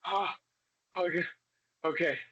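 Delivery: high, ragged catching-breath gasps; breathless huffing, high and shaky; panting. Acted. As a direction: gulp air after a panic attack. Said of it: "You're like".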